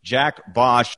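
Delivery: usual speaking voice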